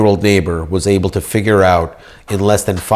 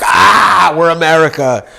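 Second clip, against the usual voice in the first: brash mock shout; 8 semitones higher, 3 dB louder than usual. Mocking brash American pride.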